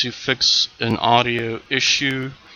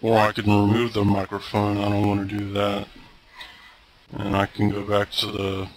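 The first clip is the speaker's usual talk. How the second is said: lower, processed voice through software; voice changer effect; 3 semitones lower than usual. Real, not acted. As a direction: talk through a voice changer filter. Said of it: "and my voice changed".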